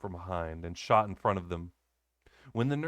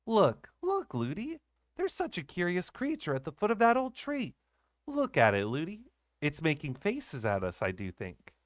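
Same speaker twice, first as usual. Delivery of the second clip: put-on high excited little girl; eager child; 7 semitones higher than usual. A narrator's reading. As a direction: point it out to nurse eagerly. Child pointing at creature.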